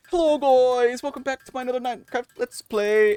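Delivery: high, loud sing-song YouTuber greeting; over-the-top Let's Play intro; Minecraft YouTuber voice. Retold or imitated. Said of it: "they were like".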